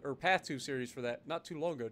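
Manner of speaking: normal talking voice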